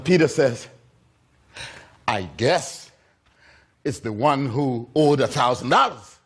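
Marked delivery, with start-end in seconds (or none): 2.1-6.2 low, hesitant concession with a pause; reluctant answer. Acted. Peter answering Jesus' question, low and reluctant. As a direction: give in grudgingly, pitched low.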